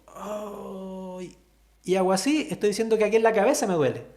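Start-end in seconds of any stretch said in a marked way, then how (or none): 0.1-1.3 soft pained little ay; a quiet groan of pain, demonstrated. Shown as a demonstration. Teacher demos a headache complaint, soft pained ay.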